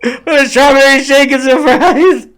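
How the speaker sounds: high, laughing, pleading whine; whiny regretful voice. Pretend. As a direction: whine it like your last wish. Imagined dying man's last regret.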